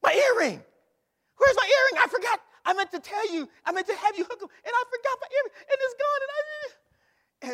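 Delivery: high, loud, flustered wife voice; panicked wife. Retold or imitated. Wife discovering her earring is gone, high and frantic.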